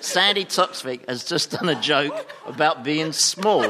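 loud, high, incredulous protest; mock outrage. Panellist calls out the host's joke, mock outraged. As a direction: halt everything in exaggerated disbelief.

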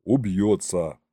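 low resigned sigh. A narrator's reading. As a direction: sigh it out, expecting the worst. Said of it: "вздохнул рядом генерал-аншеф".

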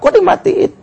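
put-on high-pitched child's protest; defiant child. Child resisting father who switched off the music, mock defiant. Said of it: "dia ngelawan".

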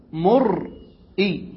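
مرئي stretched very slowly, hamza clearly sounded after the ra; hamza pronunciation. Shown as a demonstration. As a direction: drag out مرئي to show the hamza. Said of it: "بهمزة بعد راء". (نطق مرئي ببطء شديد مع إظهار الهمزة)

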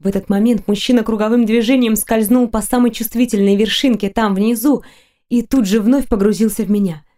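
usual speaking voice

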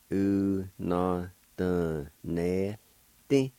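Una doneste stretched out syllable by syllable; drawn-out teaching pronunciation; slow syllable demo. Shown as a demonstration. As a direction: say it very slowly, each syllable sustained. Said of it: "like this".

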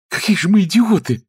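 high-pitched sudden realisation; inspired exclamation. A narrator's reading. Niece struck by an idea, inspired high exclamation. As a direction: burst out in high excitement. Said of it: "вдохновенно воскликнула Анжела".

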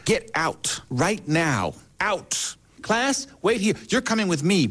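high, sharp mock Texan order; a hint of a furious teacher ordering the boy out; angry teacher.

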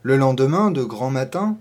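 ordinary delivery, nothing marked

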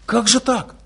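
high, mock-baffled "Как же так?"; astonished question. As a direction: throw the question up in disbelief. (высоко, с наигранным изумлением)

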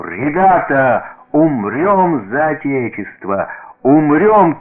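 loud, high, drawn-out battle call; fervent military exhortation; rallying cry. A narrator's reading. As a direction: shout like a general rousing the troops. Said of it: "и кричит он нам".

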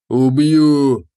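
loud roaring threat; huge fiery dragon roar. A narrator's reading. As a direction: roar it like a beast. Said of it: "взревел драконоид".

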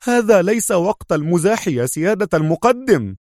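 high, heated rebuke; sharp exclamation. A narrator's reading. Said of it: "هتف خالد في حدة".

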